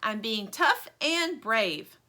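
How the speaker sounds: slow, proud declaration. Acted. Stingray tells Lumpy she is brave, slow and proud. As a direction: puff up and sound brave. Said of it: "Stingray says proudly".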